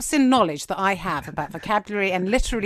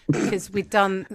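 ordinary talk, nothing marked

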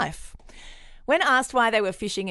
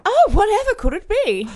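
high, coy and teasing; mock coy voice; 12 semitones higher, 6 dB louder than usual. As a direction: play innocent, all sing-song. Mock coy reply to question.

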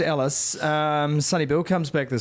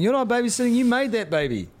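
mock scolding wife; 8 semitones higher than usual. Mock wife scolding husband who calls it babysitting. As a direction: scold him like an irate partner. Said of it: "She's gonna".

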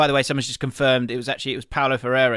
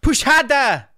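higher, louder mock gym shout; retold shout; 11 semitones higher, 7 dB louder, slower than usual. Trainer at the bench press, a louder mock shout. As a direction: bark the order like a coach.

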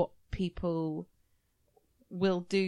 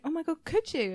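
higher, gushing mock delight; mock excited reply; 10 semitones higher than usual. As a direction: play up the delight. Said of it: "And I was like".

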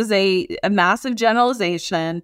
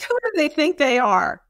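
higher-pitched mock indignation; indignant; 6 semitones higher than usual. Reacting to people's email limits, indignant. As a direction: act out offended disbelief. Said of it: "We're like".

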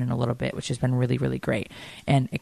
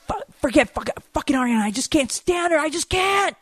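high, exasperated mock rant at her sister; mock outraged phone call; furious rant; 13 semitones higher than usual. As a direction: imitate a fed-up teen losing it. Imitating furious daughter on phone.

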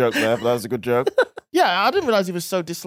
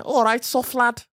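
high, slow put-on Scouse; mock Liverpool greeting, drawn out; Scouse accent; 6 semitones higher, slower than usual. Shown as a demonstration. Comedian defending his accent, squeaky mock Scouse. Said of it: "That's, that's my scouse".

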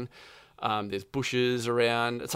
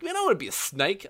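mock whiny voice; 8 semitones higher, faster than usual. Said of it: "and I'm like".

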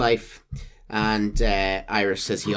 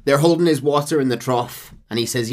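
ordinary delivery

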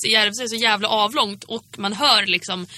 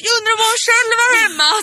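neighbor voice; 13 semitones higher, 7 dB louder than usual. Retold or imitated. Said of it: "typ vänder sig om och bara".

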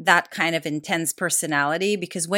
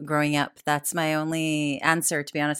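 ordinary delivery

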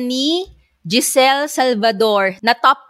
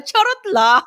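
laughing; 12 semitones higher than usual. Real, not acted. Teasing joke, laughing.

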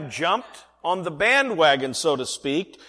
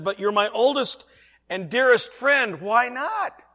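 raised, pleading, drawn-out; pleading friend voice; 8 semitones higher than usual. Acted. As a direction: plead with your oldest friend.

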